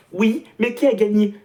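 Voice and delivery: high, slow mocking fan retort. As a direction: throw the fans' line back at them. Voicing Algerian fans' retort.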